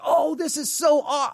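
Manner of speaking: high, far-off put-on gush; mock excitement. Pastor mimics himself gushing over a passage, high and excited. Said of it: "You're like".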